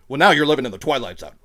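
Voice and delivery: fast, loud Murph Dog impression. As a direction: rattle it off like the detective. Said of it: "That was a really good impression".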